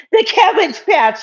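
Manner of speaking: playful high singsong repeat; high sing-song. Acted. Repeats name, second time sung out.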